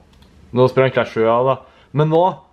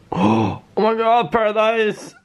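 high, drawn-out delighted exclamation; real excited fan squeal; excited outburst; 9 semitones higher, much slower than usual. Real, not acted. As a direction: squeal it out, thrilled by the find. Delighted at finding old favourite show.

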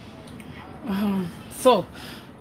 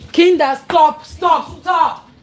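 loud, higher repeated shout; shouting stop; 8 semitones higher, 8 dB louder, much slower than usual. Real, not acted. Mother yelling at fighting kids.

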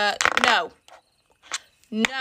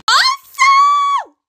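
high loud excited call; sudden high excited yell; excited shout; 8 dB louder than usual. Real, not acted. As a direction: burst out with the news. Excited announcement.

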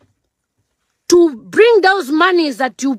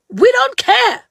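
P=A; higher defiant repeat; 9 semitones higher than usual. Real, not acted.

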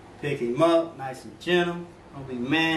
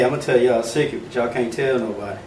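normal talking voice

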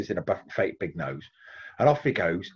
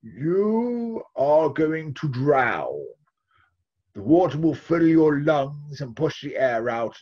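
very slow, solemn and louder; wise man's prophecy; 4 semitones higher, 4 dB louder, slower than usual. Acted. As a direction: intone the doom like an old seer. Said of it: "the wise man stroked his beard and he said".

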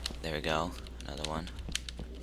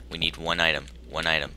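ordinary talk, nothing marked

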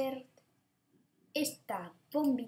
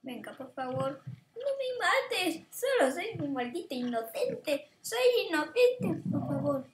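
mock pleading; 5 semitones higher than usual. Begging dinosaur again.

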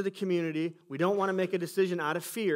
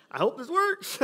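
nervous hope; 13 semitones higher, 5 dB louder, slower than usual. Retold or imitated. Nervous hope, then a laugh.

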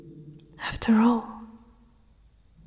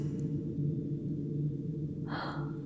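long, very soft sigh; contented sigh; 6 semitones lower, 13 dB quieter than usual. Acted. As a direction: sigh out slowly as if sinking into comfort. Princess sinking into comfort.

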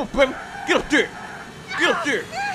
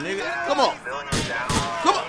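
usual speaking voice

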